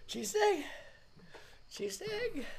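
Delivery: tiny, very high squeak. Real, not acted. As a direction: squeeze it out small and high after laughing. Squeaked out after a laughing fit.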